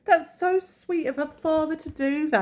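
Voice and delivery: high, gushing Cockney voice; squeaky mock-Cockney sentimentality; bit of Cockney. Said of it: "I just turned into a bit of Cockney".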